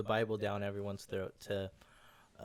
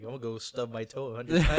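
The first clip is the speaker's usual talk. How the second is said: loud, joking eager shout; loud mock excitement; 11 dB louder than usual. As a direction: shout it like a silly dare. Acted quote, loud and far.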